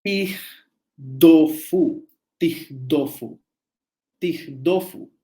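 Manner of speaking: tigdofu, lento y marcado, con i inicial; pronunciación babilónica. Shown as a demonstration. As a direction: pronuncia tigdofu despacio, sílaba por sílaba. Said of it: "fíjense cómo sonaría esto". (pronunciación lenta de tigdofu)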